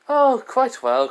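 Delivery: high, slow chipper voice; cheerful Nezu. Acted. Nezu delighting Hasashi with cheerful news, high and slow. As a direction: stretch the oh up high like a pleased mascot.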